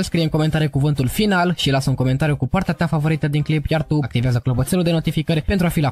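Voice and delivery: slow, low outro voice for viewers; outro read. Acted. Creator asking viewers to comment, in a slow outro read. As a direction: wind down the video calmly and slowly.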